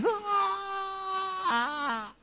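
high, mock panicked shriek; panicked cry. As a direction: let out a short inner scream of panic. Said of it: "inside I was like".

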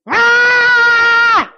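high mock baby wail; infant wail. An infant crying out when mom leaves. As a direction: let out a baby's panicked cry.

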